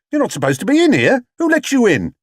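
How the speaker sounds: high, hurried alarmed call; cried out. Acted. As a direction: call out to stop the intruder. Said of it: "he cried".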